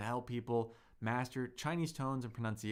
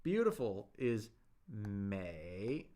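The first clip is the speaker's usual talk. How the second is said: 美 drawn out very slowly, low dipping third tone; very slow demo; 3 semitones lower, much slower than usual. Shown as a demonstration. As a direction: stretch 美 out slowly so every learner can copy it. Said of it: "like this".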